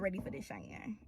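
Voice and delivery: breathy whispered name; playful unvoiced aside. Real, not acted. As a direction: whisper your own name like a secret. Teasing self-reference aside.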